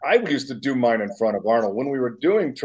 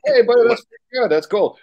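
radio host; 12 semitones higher, 6 dB louder than usual. Retold or imitated. Radio host reacting on air.

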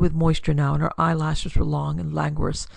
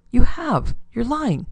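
raised, high-pitched accusation; accusing; 7 semitones higher, 4 dB louder, slower than usual. A narrator's reading. Girl accusing the bondsman of lying, indignant. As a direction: throw the accusation at him sharply.

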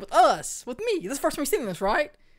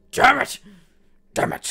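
loud, mock-furious curse; angry outburst; 4 semitones higher, 9 dB louder than usual. Voicing a game character who is not happy, angry outburst. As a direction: bark it as the upset character. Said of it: "He's like".

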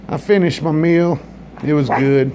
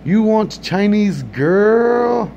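high, very slow put-on voice of the waiter; mock accented offer; 7 semitones higher, much slower than usual. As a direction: stretch out the offer slowly, higher. Comedian voices restaurant worker.

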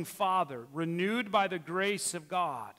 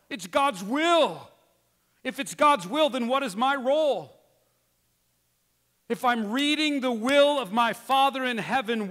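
loud, rising preacher's fervor; full-force preaching; 3 semitones higher, 7 dB louder than usual. Acted. Pastor pressing the congregation, loud and fervent. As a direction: drive it home to the pews.

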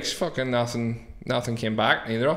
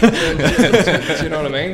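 laughing; 6 semitones higher, 11 dB louder, slower than usual. Real, not acted. Laughing at his own menu joke.

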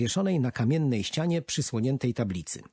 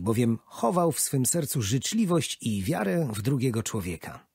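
raised, solemn public address; formal speech; 5 semitones higher than usual. Acted. Old man addresses the gathered crowd, solemnly formal. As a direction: honour the traveller to the crowd.

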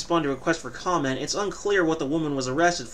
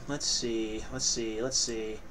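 muttering; 5 semitones lower, 8 dB quieter, slower than usual. Real, not acted. Muttering to self.

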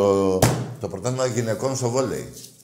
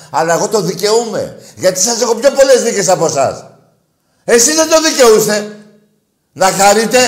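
higher and louder, taunting rival fans; host ranting at rival fans, higher and louder; heated rant; 10 semitones higher, 11 dB louder than usual. Real, not acted. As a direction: rub the wins in their faces.

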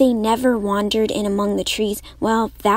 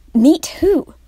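startled question, sharply higher; surprised; 7 semitones higher, 6 dB louder than usual. Acted. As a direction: react in disbelief. Tuck startled by May's plan.

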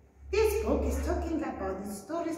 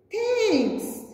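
high, grateful thanks; puppy thanking the duck after a hug; happy thanks; 10 semitones higher, 3 dB louder than usual. Acted. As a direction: beam it at your friend. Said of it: "and said".

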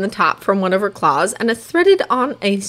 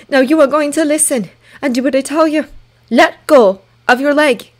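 firm command; 7 semitones higher, 4 dB louder than usual. A narrator's reading. Toothless ordering Hiccup firmly.

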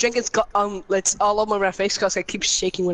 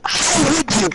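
mock offended; 6 semitones higher, 3 dB louder, slower than usual.

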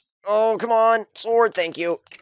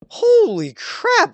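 stunned; 5 dB louder, much slower than usual. Real, not acted. Narrow escape.

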